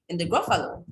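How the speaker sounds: usual speaking voice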